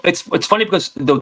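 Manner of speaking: ordinary delivery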